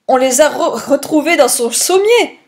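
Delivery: high, flustered protest with a stumble; stammering. A narrator's reading. Hastings insists the pearls were found, stammering. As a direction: trip over a word in disbelief. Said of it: "bégayai-je".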